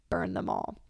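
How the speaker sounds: soft menacing whisper. Retold or imitated. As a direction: breathe burn them all like a dark secret. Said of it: "quoting Daenerys again".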